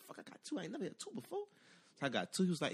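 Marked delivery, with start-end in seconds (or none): 0.1-1.4 hushed, amazed whisper; awed whisper. Retold or imitated. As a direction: breathe it out in disbelief. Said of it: "I said".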